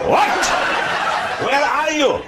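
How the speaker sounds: high, squeaky mock surprise; hammed-up disbelief, voice jumping up; mock shock. Pretend Afrikaner caller shocked to hear Mandela. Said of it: "Afrikaner shocked at Mandela".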